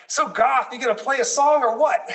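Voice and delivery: imitating a blunt Staten Island grandma; Staten Island accent. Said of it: "in, like, her Staten Island accent".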